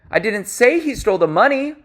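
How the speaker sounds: whole sentence pitched high, lifting at the end; high rising tone. Shown as a demonstration. Coach showing tonal variation across sentences, high-pitched delivery. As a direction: say it all high up, as if protesting. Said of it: "this occurs across sentences as well".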